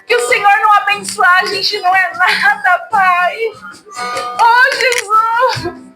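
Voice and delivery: ordinary delivery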